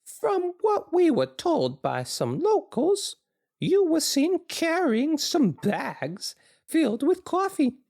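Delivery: very high, slow interrogating voice; squeaky high, slow and accusing; high reporter voice. Acted. Jim voiced in the reading.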